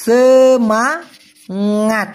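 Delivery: সেমাঙাত said very slowly and clearly, se-ma-ngat; slow pronunciation. Shown as a demonstration. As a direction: model সেমাঙাত slowly for the class to repeat. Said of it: "উচ্চারণটা হবে সেমাঙাত".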